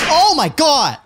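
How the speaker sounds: high, sudden exclamation of disbelief; real shocked outburst. Real, not acted. Streamer stunned by the mouse sensitivity, shocked outburst.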